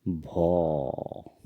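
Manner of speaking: "भो।" with a long held o; Assamese pronunciation. Shown as a demonstration. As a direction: draw out "भो।" for learners. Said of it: "इसका उच्चारण होता है".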